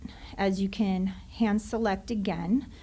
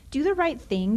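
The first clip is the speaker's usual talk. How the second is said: higher and louder, insistent; emphatic demand; 5 semitones higher, 5 dB louder than usual. Real, not acted. Teacher pressing the school board, in a louder emphatic demand. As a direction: hammer the demand home.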